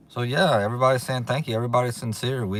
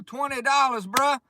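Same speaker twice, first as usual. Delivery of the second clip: high, delighted exclaim; thrilled amazement at the gift; real excitement, pitched high; excited high call. Real, not acted. Excited about donation.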